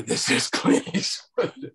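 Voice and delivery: slow, lightly voiced Eastwood; Clint Eastwood. Clint Eastwood insisting on the phone, slow and barely voiced. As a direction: drawl it out like Eastwood. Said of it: "I don't do a very good Clint Eastwood".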